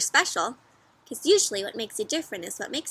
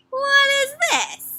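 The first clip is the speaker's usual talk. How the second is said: much higher, slow, wide-eyed wonder; mock amazement; 14 semitones higher, 6 dB louder, slower than usual. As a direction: act amazed for the kids.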